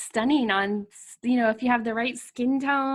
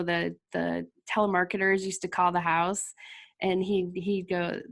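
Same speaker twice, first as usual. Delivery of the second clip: normal talking voice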